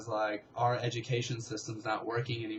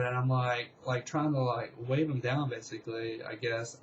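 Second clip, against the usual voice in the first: usual speaking voice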